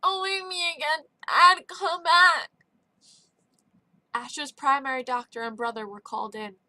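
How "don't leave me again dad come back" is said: higher, louder, slurred crying out; a waking boy slurring for his father; deep slur. A narrator's reading. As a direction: call out half-asleep, slurring. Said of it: "he said with a deep slur".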